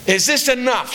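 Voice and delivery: sharp, high demanding question; demanding, high-pitched retort; with an attitude. Retold or imitated. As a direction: toss it out with attitude. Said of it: "She said it with an attitude".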